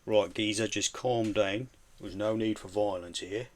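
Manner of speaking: put-on lightly done London accent, slow calm down; put-on Cockney. Streamer talking to Bizzle in his accent, mock Cockney. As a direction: soothe him like a London geezer. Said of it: "speak to Bizzle in his own voice".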